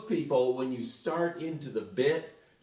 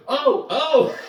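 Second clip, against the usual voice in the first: much higher and louder startled oh, oh; embarrassed oh; 12 semitones higher, 9 dB louder than usual. Retold or imitated. Stocker realizing a brassiere is a bra, embarrassed yelp. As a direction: caught out, it suddenly dawns on him.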